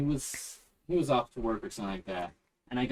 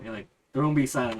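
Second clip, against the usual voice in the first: ordinary talk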